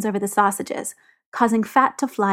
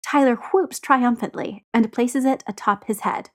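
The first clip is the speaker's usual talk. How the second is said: plain reading voice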